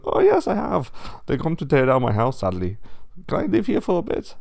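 put-on high, loud German accent; German-accented pig. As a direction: answer the cousin loudly in a German accent. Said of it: "but he's German".

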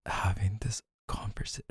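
playful whispered aside; murmured teasing to a tipsy partner. Acted. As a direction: whisper it like a secret tease. Teasing drunk girlfriend, dropping voice.

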